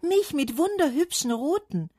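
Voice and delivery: high, loud, delighted boast; calling out. A narrator's reading. Cherry tree showing off its fruit, calling out. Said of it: "rief der Kirschbaum".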